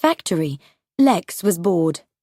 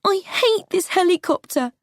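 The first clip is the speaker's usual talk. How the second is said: put-on much higher, scared child's voice; boy afraid in the helicopter, high and scared; frightened boy; 11 semitones higher than usual. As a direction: pitch it up like a terrified nine-year-old. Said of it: "Lex said".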